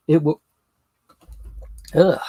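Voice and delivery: hiccup breaks the words; talking through hiccups and burps. Real, not acted. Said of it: "Gee, I'm hiccuping, I'm burping".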